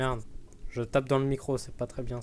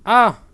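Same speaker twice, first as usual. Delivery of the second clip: loud startled scream; scared horror game streamer; 7 semitones higher, 14 dB louder than usual. Real, not acted. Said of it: "Il m'a fait peur".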